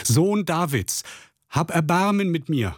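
sehr hoch und langsam gedehnter Schrei; noch lauter schreien. A narrator's reading. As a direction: verzweifelt flehend, Stimme ganz nach oben. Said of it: "schrie noch viel lauter". (Bettler schreit trotz Verbot zu Jesus, hoch flehend)

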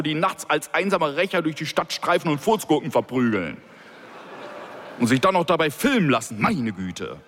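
a police director fuming about the senator's call; ranting boss. A narrator's reading. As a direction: vent and sneer.

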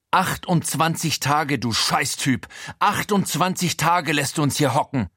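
high, outraged scolding; wütender Vorwurf. A narrator's reading. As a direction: lay into him after weeks locked up. Befreite ohrfeigt und beschimpft Frankie. (empörtes Schimpfen, angehobene Stimme)

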